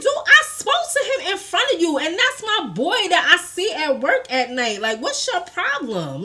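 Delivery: high-pitched retold protest, easing down by the end; indignant protest. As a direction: argue back in disbelief, starting very high. Retold quarrel with boyfriend.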